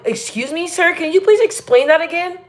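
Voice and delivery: high, mock-polite plea; put-on politeness. Imagined visitor asking a local to repeat directions, mock-polite.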